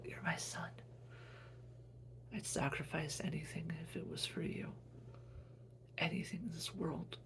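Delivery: soft whispered devotion; whispered motherly vow; dying whisper. Acted. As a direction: breathe it out as last words. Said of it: "She said".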